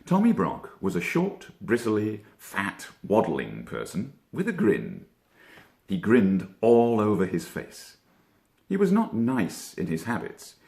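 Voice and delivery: ordinary delivery, nothing marked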